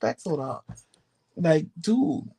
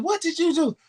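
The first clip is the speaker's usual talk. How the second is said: shocked question; 14 semitones higher than usual. Retold or imitated. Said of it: "And she said".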